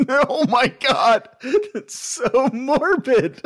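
high, slow laughing words. Real, not acted. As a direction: laugh through it. Laughing at sad joke.

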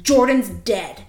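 mock-angry blurt of bad news; angry snap. Dad snapping around from furious gardening, imitated angry snap. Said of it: "he fucking snaps around".

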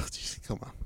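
exasperated under breath. Retold or imitated. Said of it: "I'm like".

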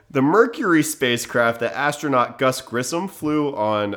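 usual speaking voice

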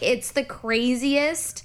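normal talking voice

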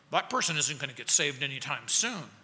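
low, soft aside to oneself; privately dismissive, low and quiet; quiet dismissive thought. Acted. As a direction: write someone off under your breath.

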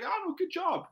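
a quick high good job; mock coach praise. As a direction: cheer them on like a coach.

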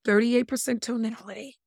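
normal talking voice

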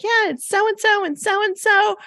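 imitated child's chatter, pitched very high; high little-kid voice; little girl. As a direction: list friends like a small girl. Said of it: "she starts telling me about her friends".

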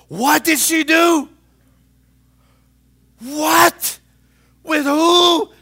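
hammed-up church gossip; mock gossip. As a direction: play a nosy gossip gasping at scandal. Gossip voice Jesus didn't use.

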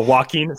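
"Joaquin" now said wah-KEEN; corrected Joaquin. Real, not acted. Host accepting a friend's correction, Joaquin said wah-KEEN. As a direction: start "Joaquin" with a W, end on keen. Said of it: "I really need you to say Joaquin".

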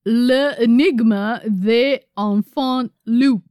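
loud, slow, laboured French accent; clumsy French accent. Shown as a demonstration. Host attempts the surgeon's book title, laboured French accent. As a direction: sound out the French word by word, loudly. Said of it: "my shitty French accent".

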